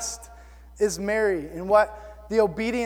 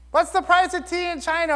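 higher, blurted random question; student blurting out; 8 semitones higher than usual. Retold or imitated. Kid interrupting the teacher's lesson with a random question, blurted out. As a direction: mimic a kid calling out in class.

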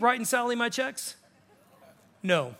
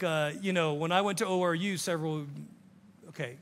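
ordinary delivery

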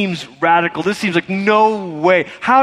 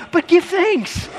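mock baffled listener; 12 semitones higher, slower than usual. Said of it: "And then you're like".

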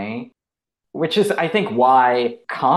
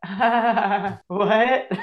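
high mock bewilderment through a laugh; baffled audience; 10 semitones higher than usual. Imitating a New York audience baffled by a costume, high mock bewilderment. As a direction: imitate a thrown audience, pitched way up. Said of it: "the audience was like".

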